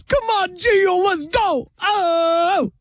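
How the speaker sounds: high, drawn-out yell from the stands; yelling dad. Acted. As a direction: holler like an overeager sports dad. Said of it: "is yelling too much in the stands".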